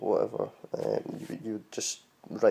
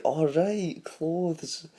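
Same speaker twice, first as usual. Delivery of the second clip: dawning realisation; 7 semitones higher, 3 dB louder, much slower than usual. Retold or imitated. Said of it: "And I was like".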